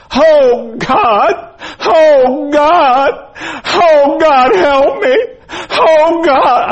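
very high, loud wail of grief; full-voiced, drawn-out imitated scream; anguished screaming. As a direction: wail like a man in agony, crying out to God. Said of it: "heard somebody out in the hallway screaming".

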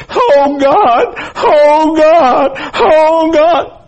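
very high, loud drawn-out wail; imitated scream of grief, full voice; anguished wailing. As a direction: cry out to God like a broken father. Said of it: "a man wail like that".

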